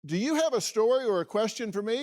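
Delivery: outro call to action. Acted. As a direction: pitch it up like a channel sign-off. Host's closing call to action.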